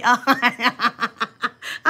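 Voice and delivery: real burst of laughter; amused, unplanned laugh. Real, not acted. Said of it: "I'm just laughing".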